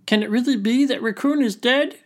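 high, small creature voice; little crayfish voice. Acted. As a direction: ask in a tiny high voice. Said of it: "said the little crayfish".

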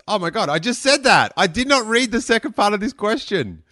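high, loud burst of disbelief; astonished. Real, not acted. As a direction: exclaim it, amazed at the coincidence. Discovers they already answered it.

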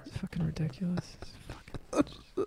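voiceless, wheezing laughter; barely audible, cracking up; helpless laughter. Real, not acted. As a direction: squeeze the words out through a laughing fit. Cracking up mid-conversation.

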